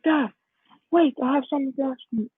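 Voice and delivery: high, hurried call to wait; retold boy stopping his father; urgent appeal. Deku asking dad to wait for a question, urgent.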